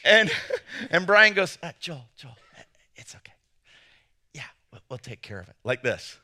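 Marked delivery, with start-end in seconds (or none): 1.6-5.6 soft, half-whispered reassurance; hushed aside. Retold or imitated. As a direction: lean in and whisper it so only he hears. Friend quietly intervenes nearby.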